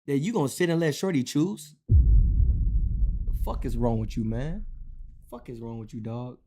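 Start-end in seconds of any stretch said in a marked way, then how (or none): 1.9-4.6 low, slow, disgusted; low slow scolding. Real, not acted. Scolding a viewer who lets her choose, low and slow. 5.3-6.4 low, soft, muttered disbelief; quiet disgust. Real, not acted. Scolding the viewer again, low and much softer.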